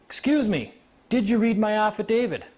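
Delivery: high, emphatic retold demand; acted-out firm challenge; insistent interruption. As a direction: cut in and demand an answer. Acting out brother's insistent interruption.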